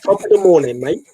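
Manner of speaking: mock British accent.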